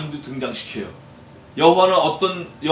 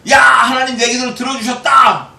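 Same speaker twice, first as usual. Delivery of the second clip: high, loud victorious cry; joyful shout; 7 semitones higher, 8 dB louder than usual. Acted. Psalmist shouting after praying, jubilant yell. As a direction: shout that the prayer was heard.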